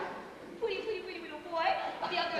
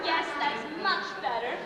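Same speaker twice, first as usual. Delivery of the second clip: usual speaking voice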